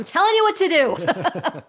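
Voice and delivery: loud, high, emphatic command; playful bossy shout. Real, not acted. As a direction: belt it out as a joking order. Playfully reverses her own disclaimer.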